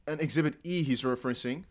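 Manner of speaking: usual speaking voice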